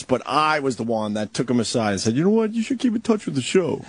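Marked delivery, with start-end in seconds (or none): 2.1-3.8 much higher, mock-helpful advice voice; high-pitched self-quote. Host mimicking himself advising the intern, high-pitched self-quote. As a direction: pitch your own old advice way up.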